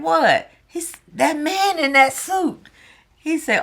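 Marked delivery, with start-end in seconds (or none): 0.0-0.5 high loud what; quick puzzled kid voice; imitating son. Son answering his friend, high puzzled voice. 1.1-2.7 high voice pointing it out; imitating friend. Friend pointing out the costumed man, high kid voice.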